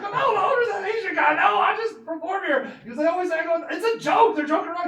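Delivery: high, loud, laughing protest; laughing explanation. Retold or imitated. Explaining through laughter to the fan that he does not own the club. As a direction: laugh while setting the fan straight.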